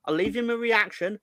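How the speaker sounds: usual speaking voice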